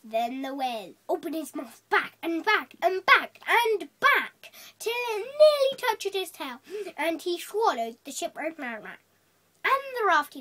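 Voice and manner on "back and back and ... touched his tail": each "back" punched harder, climbing to a high, loud last one; swelling emphasis on every "back"; building repetition. A narrator's reading. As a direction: build "back and back and back" ever louder and higher. Whale's mouth opens ever wider.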